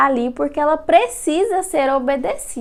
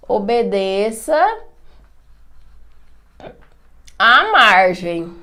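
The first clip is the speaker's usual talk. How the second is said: slow correction note; 4 semitones lower, slower than usual. Shown as a demonstration. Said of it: "eu vou marcar assim".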